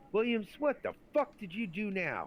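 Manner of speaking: high, loud chewing-out; imitated exasperated shout; retold angry sergeant. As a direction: yell it like a first sergeant dressing down a soldier.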